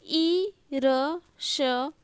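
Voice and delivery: ईरष्या very slowly, sound by sound, "ee-ra-sha-ya"; drawn-out, separated sounds in ईरष्या. Shown as a demonstration. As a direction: spell out ईरष्या with gaps between sounds. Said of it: "अब देखिए यहाँ पे".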